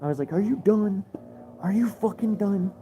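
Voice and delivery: high-pitched mock yell in his face; retold scream. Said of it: "I just screamed in his face".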